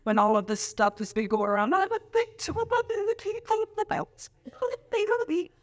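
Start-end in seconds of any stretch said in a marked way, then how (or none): 1.7-5.5 high, whiny mimic of a complaining member; mock whiny victim. Pastor mimicking Lacey claiming to be a victim. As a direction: whine it like a wounded member. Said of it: "this Lacey over here".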